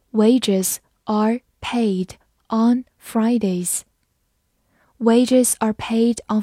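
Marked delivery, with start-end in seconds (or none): 0.1-3.8 measured, with a gap after each word; slow read-along. Shown as a demonstration. Teacher reads along with students, slow read-along. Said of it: "我们慢读一遍".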